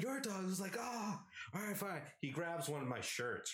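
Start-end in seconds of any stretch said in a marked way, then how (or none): 0.0-0.4 short high shout; high yell. Retold or imitated. Arguing over whose dog, high yell. Yelling argument. 0.8-2.0 high exasperated giving in; grudging shout. Retold or imitated. Roommate gives in to the argument, high and grudging.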